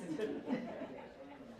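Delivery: light laugh trailing away; real short laugh. Real, not acted. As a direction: let a small laugh out. Laughs at own modest opener.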